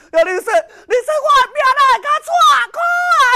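high, fast, loud wailing complaint; a shrill sobbing cry of woe; wailing lament. Acted. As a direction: wail it out like a widow in tears. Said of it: "是甲目屎流目屎滴".

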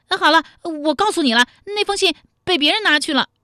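high, loud, flustered confession; hurried giving-in. Acted. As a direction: give in urgently at a high pitch. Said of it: "武灵爵忙说". (慌忙高声招认)

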